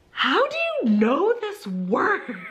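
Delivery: high, stretched-out disbelief; shocked. Retold or imitated. Teacher to student after a rude word, shocked. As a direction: draw out the question in high-pitched astonishment. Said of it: "I was shocked".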